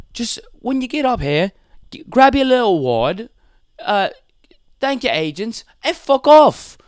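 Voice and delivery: high-pitched British impression, punching the last words; Ricky Gervais. Host scolds award winners at Golden Globes, mocking impression. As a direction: imitate a British host, high, ending in a loud kicker. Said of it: "I loved that Ricky Gervais speech".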